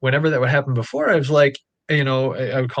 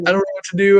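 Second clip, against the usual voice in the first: panicked; 8 semitones higher, 3 dB louder, faster than usual. Retold or imitated. Panicked call to partner.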